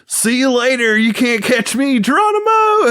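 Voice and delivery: high, hammed-up taunt rising to a yell; Tex Conway. Texan villain jumps off the building, high mock yell.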